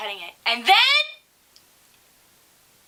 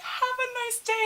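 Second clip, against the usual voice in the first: very high, softer singsong send-off; strained cheery farewell; 13 semitones higher, 5 dB quieter than usual. Retold or imitated. Teller seeing the mom off while laughing, high and squeaky. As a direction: squeak out a polite goodbye.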